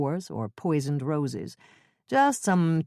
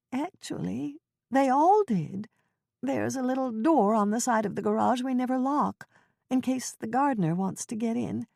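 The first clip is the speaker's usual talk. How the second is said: put-on Ethel's fretful old voice; 7 semitones higher than usual. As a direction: quaver it like a flustered old neighbor. Ethel voiced in cozy mystery.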